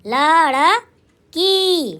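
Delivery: लड़की pulled apart very slowly, ending on a long की; sounded out slowly. Shown as a demonstration. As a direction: stretch every sound of लड़की for spelling. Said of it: "ये है लड़की".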